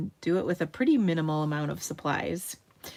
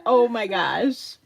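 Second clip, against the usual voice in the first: laughing; 4 semitones higher, 6 dB louder, slower than usual. Real, not acted. Laughing exasperation.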